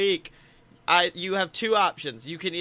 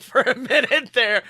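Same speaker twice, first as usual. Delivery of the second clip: laughing; 7 semitones higher, 7 dB louder than usual. Real, not acted. Laughing at his confusion.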